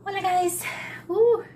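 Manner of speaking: high, bright hello to viewers; excited greeting. Real, not acted. Vlogger greeting her viewers, high excited cheer.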